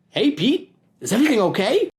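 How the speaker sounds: put-on high kid voice, friendly and curious; a bright boy checking on his friend; David's voice. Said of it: "Oh, no, Peter thought".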